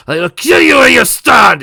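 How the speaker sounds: very loud furious roar. Acted. Villain roaring at Pinky, furious roar. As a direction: roar the threat at her. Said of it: "he roared".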